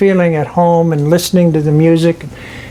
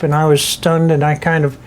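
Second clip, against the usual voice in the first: ordinary delivery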